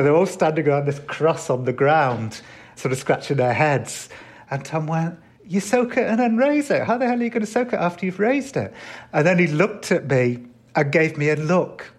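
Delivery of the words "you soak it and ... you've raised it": much higher, mock-exasperated telling-off; a boss losing patience with idiots, imitated; higher-pitched imitated exasperation at bumbling followers; exasperated scolding. Klan leader scolding confused members.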